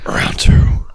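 hoarse whispered growl; Batman voice. Acted. Co-host having another go at the impression, rasping Batman whisper. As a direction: growl it out without voicing. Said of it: "Batman voice".

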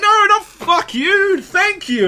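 very high, loud mock protest; hammed-up pleading to be understood; frantic correction. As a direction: stretch it out, pitched right up. Acted correction of a misunderstanding.

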